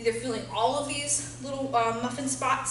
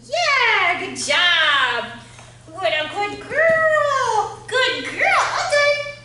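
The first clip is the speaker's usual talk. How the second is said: squeaky praising a dog; 11 semitones higher, 9 dB louder, much slower than usual. Real, not acted. Praising dog after puzzle.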